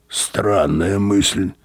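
slow, drawn-out disapproval; disapproving. A narrator's reading. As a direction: stretch it out with disapproval. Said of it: "неодобрительно сказал Мозес".

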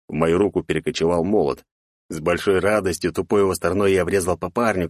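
plain reading voice

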